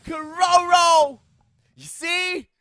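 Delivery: loud, high celebratory shouting; hype shout. Real, not acted. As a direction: roar it like a champion call. Co-caster celebrates the clutch.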